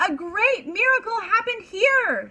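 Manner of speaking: high, loud triumphant shout. Acted. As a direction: shout the magic words to stop the pan. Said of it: "Sadie shouted".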